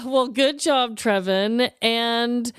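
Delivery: normal talking voice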